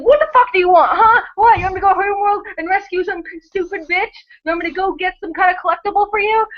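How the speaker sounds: snapping, high and irritated; fed up Commander Shepard snarling; pissed off. Retold or imitated. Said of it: "I'd be pissed".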